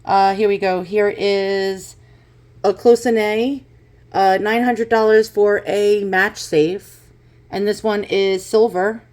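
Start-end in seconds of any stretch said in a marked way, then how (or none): none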